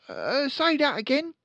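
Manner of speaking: put-on high, loud boyish reply; eager son. Son answering his father, high and keen.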